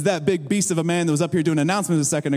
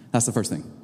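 normal talking voice

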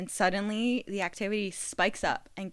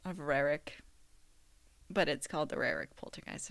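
odd phrasing; 5 semitones lower, 5 dB quieter than usual. Pretend. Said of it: "I don't know why I said it like that".

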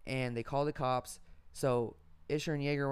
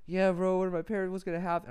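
panicky friend; 5 semitones higher, 4 dB louder than usual. Retold or imitated. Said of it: "He's bugging out".

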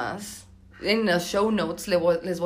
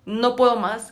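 retold shout; 4 semitones higher, 5 dB louder than usual. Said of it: "grité".

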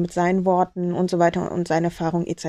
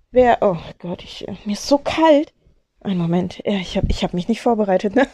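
ordinary talk

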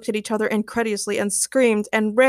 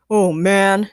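mildly exasperated, louder groan of words; complaining; 2 semitones lower, 5 dB louder than usual. A narrator's reading. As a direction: grumble it in character. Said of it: "James complained".

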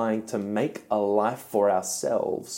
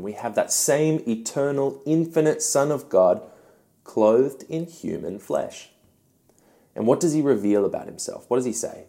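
normal talking voice

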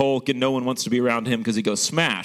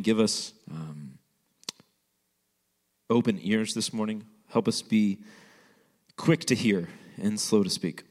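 lower, hushed prayer voice; 6 semitones lower than usual. Acted. Pastor leads an opening prayer.

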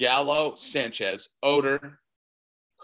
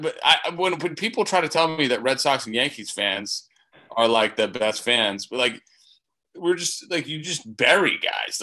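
ordinary delivery

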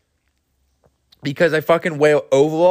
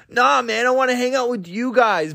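druggie dwarf; 7 semitones higher than usual. Retold or imitated. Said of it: "Druggy answers friends".

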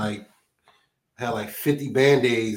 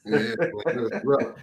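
ordinary delivery

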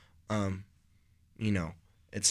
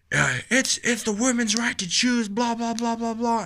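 much higher, whiny mock voice of the upset student; mocking angry student; 13 semitones higher, 4 dB louder than usual. Mocking the classmate who got mad, in a high whiny voice. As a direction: parody the ranting classmate, high and dismissive. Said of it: "but he was like".

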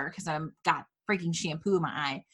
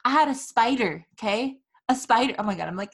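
usual speaking voice

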